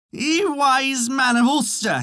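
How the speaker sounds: high, slow, drawn-out cry. A narrator's reading. Tiny man swimming in the ale, crying out. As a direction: call out in a high, plaintive voice. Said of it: "he cried".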